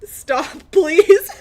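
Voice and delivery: usual speaking voice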